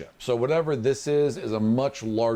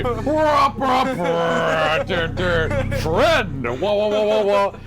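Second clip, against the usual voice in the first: scanner beeps; 7 semitones higher, 6 dB louder, much slower than usual. Acted. GM voices scanning noises.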